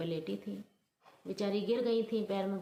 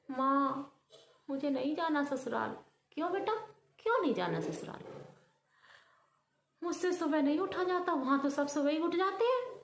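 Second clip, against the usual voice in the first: whiny daughter; 6 semitones higher than usual. Acted. Daughter whining to mother.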